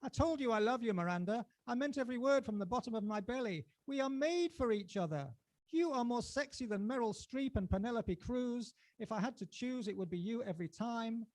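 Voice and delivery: mild mock shout. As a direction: call it down the street after her. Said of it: "I'm shouting it out now".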